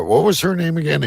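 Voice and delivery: ordinary delivery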